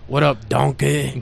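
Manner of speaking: slow, drawn-out Scottish ogre voice; amateur Scottish-accented cartoon impression; Shrek. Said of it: "just think of a Shrek voice".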